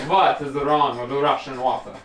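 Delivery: loud, hammed-up Russian accent. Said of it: "I can speak in a Russian accent".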